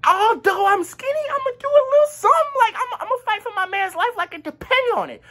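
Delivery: high-pitched, hammed-up fighting talk; hyped-up bravado. Skinny friend vowing to fight for his man, hyped-up bravado. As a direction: squeal it out like a pumped-up underdog. Boasts he would have fought.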